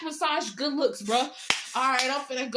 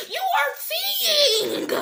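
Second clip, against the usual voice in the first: high, slow, laughing yell; half laughing, half yelling; strained shout; 11 semitones higher, 3 dB louder, much slower than usual. Real, not acted. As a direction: stretch it out in a strained cry. Heated rant peak.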